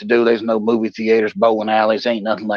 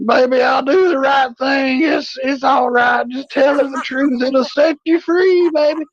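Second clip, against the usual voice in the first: put-on drunk soothing; 13 semitones higher, slower than usual. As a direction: soothe her like a sloppy drunk.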